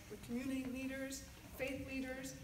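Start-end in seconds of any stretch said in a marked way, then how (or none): none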